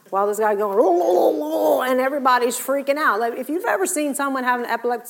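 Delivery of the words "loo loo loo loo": high, loud gurgling loo sounds; mock seizure babble. Preacher imitating the seizing boy, loud gurgling loo.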